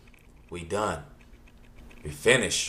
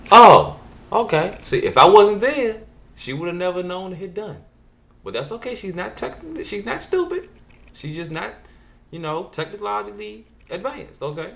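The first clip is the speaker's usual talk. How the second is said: mock exasperation; 9 semitones higher, 7 dB louder than usual. Mock exasperation about mom.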